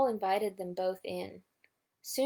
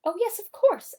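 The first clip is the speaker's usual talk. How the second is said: much higher, louder oh yes; eager reply; 11 semitones higher, 9 dB louder than usual. A narrator's reading. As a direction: answer brightly. Owl answering eagerly.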